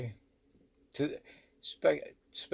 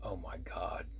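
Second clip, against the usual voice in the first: hushed, lower, awestruck; stunned whisper; 4 semitones lower, 7 dB quieter, slower than usual. Retold or imitated. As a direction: whisper it as if unable to believe it. Stunned on recognising the woman.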